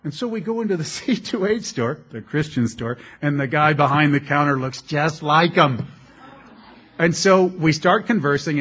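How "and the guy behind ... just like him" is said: ordinary talk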